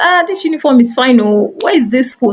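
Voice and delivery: admiring exclamation. Retold or imitated. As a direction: voice your impressed reaction to the neighbor. Admiring a neighbor's school uniform.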